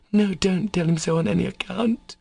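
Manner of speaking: high-pitched anxious protest; alarmed. A narrator's reading. Katya stops Alyosha telling him, in alarm. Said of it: "cried Katya in alarm".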